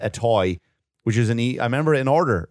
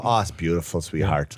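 mock sweet parent; 7 semitones lower than usual. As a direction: coo it to the kid, half-hearted. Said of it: "I go".

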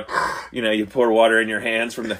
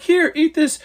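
1 mock cast offering; 12 semitones higher, much slower than usual. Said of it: "They're like".

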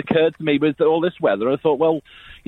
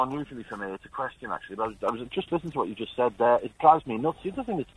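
muffled, faint phone line; bad phone line; 4 semitones lower, 9 dB quieter, faster than usual. Real, not acted. Caller on a bad mobile line, muffled and faint. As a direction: sound like a breaking-up phone call. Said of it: "Sort your phone out".

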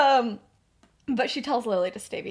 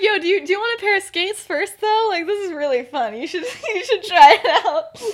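high, loud, eager pitch to a friend; excited invitation; 9 semitones higher, 9 dB louder than usual. Retold or imitated. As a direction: talk them into the fun, high and lively. Excited invitation to skate, acted.

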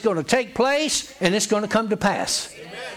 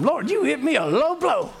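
high, amused mock protest; laughing quote; 8 semitones higher than usual. Preacher retells another minister's complaint to the Lord, laughing. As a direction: quote it through laughter, pitched higher.

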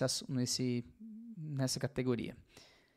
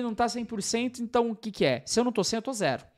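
mocking the man's thinking; 8 semitones higher, 3 dB louder than usual. Voicing the man's all-or-nothing thinking.

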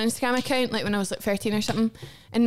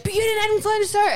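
high, accusing mock jab; mock accusing jab, pitched higher; mocking accusation; 10 semitones higher, 3 dB louder than usual. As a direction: throw it at her like a gotcha. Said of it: "they're like".

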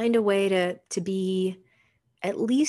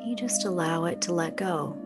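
soft spa whisper; 5 dB quieter than usual. Acted. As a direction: ease them into calm, unhurried. Leads a guided meditation.

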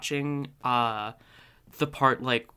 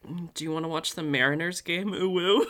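lightly voiced coy Charlie, pitched higher; a hint of a giddy, coy father, higher; goofy Charlie voice; 5 semitones higher than usual. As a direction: tease it like a bashful dad with a crush. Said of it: "He's like".

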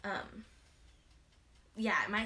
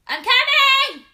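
loud high slow call to another room; 23 dB louder, slower than usual. Real, not acted. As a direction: yell back that you're on your way. Called to dinner, answering.

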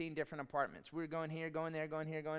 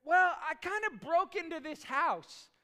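high, loud, guilty confession; sheepish excited wife; 10 semitones higher, 8 dB louder than usual. Retold or imitated. Wife confessing she entered the house, high sheepish voice. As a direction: admit it with a grin. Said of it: "She's like".

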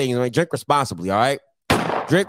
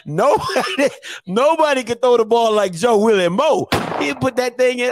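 higher-pitched imitation of a hyped old-timer; old head bragging; 6 semitones higher than usual. Old head praising Joe Willie Moe's arm, mock bragging. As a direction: boast about a legend like an old head. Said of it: "that's how they be sounding".

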